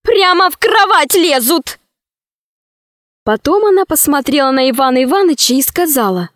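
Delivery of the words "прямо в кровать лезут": high-pitched, petulant grumble; capricious princess. A narrator's reading. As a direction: whine about it like a fussy princess. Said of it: "сказала принцесса капризным голосом". (капризным голосом принцессы)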